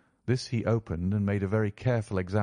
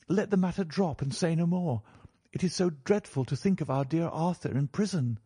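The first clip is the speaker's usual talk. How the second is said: put-on higher-pitched young lady, pleading; distressed niece in a raised, gentle-volume voice; 8 semitones higher than usual. A narrator's reading. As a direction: plead earnestly for your cousin. Mary pleads for Arthur.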